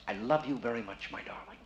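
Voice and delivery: soft, tender love line, fading away; quiet romantic murmur dying off; lot of feeling. Shown as a demonstration. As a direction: demonstrate a hushed, passionate declaration. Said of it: "say it with a lot of feeling".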